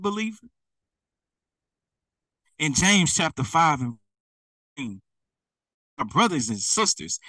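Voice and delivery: ordinary talk, nothing marked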